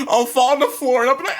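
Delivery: ordinary talk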